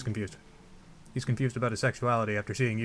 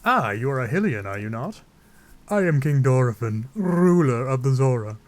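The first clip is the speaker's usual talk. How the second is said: grand, theatrical monarch voice; rolled, declaimed introduction of a ruler; King Dorephan; 3 dB louder than usual. Acted. Zora king greets the Hylian visitor, grand put-on voice. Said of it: "I don't know why I'm giving him that voice".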